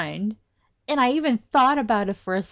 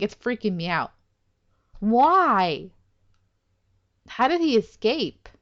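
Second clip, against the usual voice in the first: ordinary talk, nothing marked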